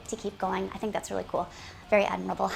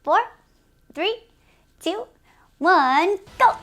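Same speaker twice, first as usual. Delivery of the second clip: countdown; 7 semitones higher, 10 dB louder, slower than usual. Real, not acted. Counting down the timer.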